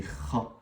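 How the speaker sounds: "Kha" with a rasped back-of-throat fricative; Arabic kha. Shown as a demonstration. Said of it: "le K H". (« Kha » avec un kh raclé, à l'arabe)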